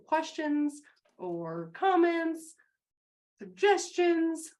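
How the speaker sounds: slow, high, stretched invitation; drawn-out invite. Real, not acted. Coach inviting audience questions, slow and high. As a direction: stretch each word out, inviting.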